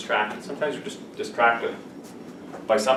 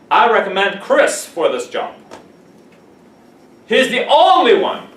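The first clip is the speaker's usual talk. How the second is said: loud, slow, hammed-up praise building higher; grand mock endorsement; 8 semitones higher, 9 dB louder, slower than usual. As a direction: oversell the recommendation, booming. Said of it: "joking fake recommendation".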